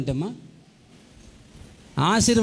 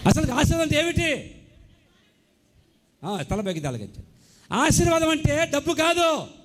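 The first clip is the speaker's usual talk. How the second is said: higher, pointed questions to the church; high rhetorical preaching; 7 semitones higher than usual. Acted. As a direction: challenge them, pitched up.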